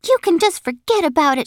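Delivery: high, scolding shout; shouting after someone. A narrator's reading. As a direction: holler a warning after him. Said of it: "she shouted after him".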